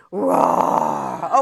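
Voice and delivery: big playful lion roar. Shown as a demonstration. Teacher leads the kids' last lion roar, big roar. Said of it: "One more time".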